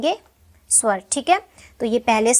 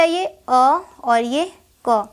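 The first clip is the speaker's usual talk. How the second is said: slow spelling out; much slower than usual. Shown as a demonstration. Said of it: "teacher spells out sounds slowly".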